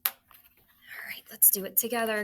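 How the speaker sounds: quick whispered instruction; fast whisper. Real, not acted. Teacher quickly whispering to students to spell together. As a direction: hush it out fast.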